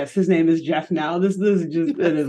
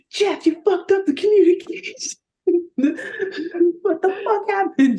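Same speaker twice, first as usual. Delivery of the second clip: much higher mock furious yell; Domax yelling; 11 semitones higher than usual. Boss Domax storms in on Jeff, mock furious yell. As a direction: boss chewing out Jeff, hammed up. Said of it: "Domax storms in".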